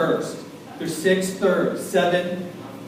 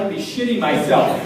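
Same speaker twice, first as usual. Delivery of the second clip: usual speaking voice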